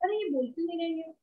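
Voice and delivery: soft, fretful parent. Retold or imitated. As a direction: fret quietly like a worried mother. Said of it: "ऐसा नहीं कि". (चिंतित माता-पिता की धीमी, परेशान आवाज़)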